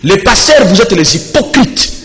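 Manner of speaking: emphatic, declamatory delivery with weighty pauses; forceful preaching. Real, not acted. Preacher rebuking pastors over celebrations in Egypt, forceful preaching.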